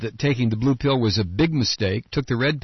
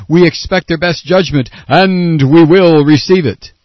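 mock slow, emphatic chairman, louder and building to a loud finish; Ike Skelton; 3 semitones higher, 8 dB louder than usual. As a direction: ham up a pompous committee chair, hitting every word. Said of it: "responded slowly and emphatically".

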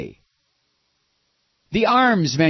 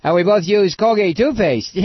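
mock Bush; 5 semitones higher, 6 dB louder than usual. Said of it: "the geek's mouth was".

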